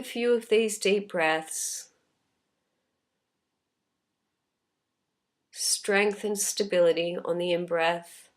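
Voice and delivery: unhurried, calm meditation voice with long pauses; guided meditation. Real, not acted. Teacher leading listeners through a body scan, calm guided meditation. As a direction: gently guide them inward, leaving space after each phrase.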